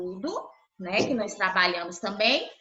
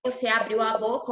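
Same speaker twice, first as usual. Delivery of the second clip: usual speaking voice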